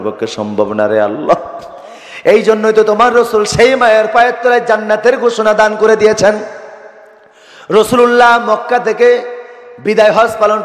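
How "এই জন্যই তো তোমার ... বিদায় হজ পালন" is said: high, loud preacher's chant; ringing declaration on a raised pitch; full-force preaching. Real, not acted. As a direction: proclaim it to the whole congregation.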